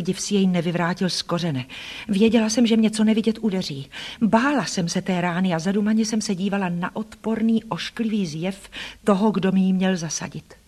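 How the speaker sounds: usual speaking voice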